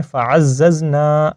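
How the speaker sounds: فَعَزَّزْنَا with the final "na" held long; elongated NA. Shown as a demonstration. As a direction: stretch the nun at the end of فَعَزَّزْنَا. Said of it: "نون کو میں نے لمبا کیا ہے". (فَعَزَّزْنَا کے نون کو لمبا کر کے)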